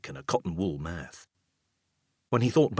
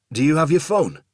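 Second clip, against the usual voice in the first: higher, louder question into the dark; calling out; 8 semitones higher, 9 dB louder than usual. Acted. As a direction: call out to the unseen father. Son calling into darkness.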